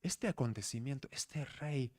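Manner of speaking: soft, hushed wonder; awe. Acted. Preacher voicing the wonder at the newborn king, softly. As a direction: breathe it out in amazement. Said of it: "ese asombro por".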